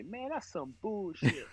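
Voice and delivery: put-on slow, high West African accent; drawn-out West African accent impression. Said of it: "in his West African accent".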